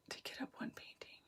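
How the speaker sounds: whispered To kit up one painting to herself; soft unvoiced rereading. Real, not acted. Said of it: "rereads the comment".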